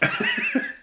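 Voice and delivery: real high laugh bursting out; a squeaky giggle; high squeal of laughter. Real, not acted. Laughing while retelling his friend's visit.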